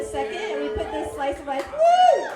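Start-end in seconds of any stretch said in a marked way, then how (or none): 1.7-2.3 cheer. Real, not acted. Host cheering for Kelly.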